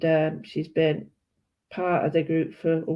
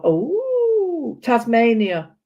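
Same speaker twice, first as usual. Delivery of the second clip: high, bright mock realisation; imitating surprise; 5 semitones higher, 6 dB louder, much slower than usual. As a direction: play a visitor going oh, Tasmania. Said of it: "they go on it and they go".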